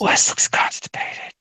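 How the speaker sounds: slow stage whisper; whispered aside. Real, not acted. Co-host teasing Wes while the host talks, whispered.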